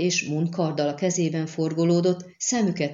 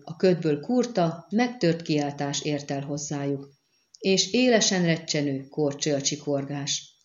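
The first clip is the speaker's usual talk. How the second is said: ordinary delivery, nothing marked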